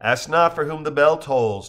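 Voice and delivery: ordinary delivery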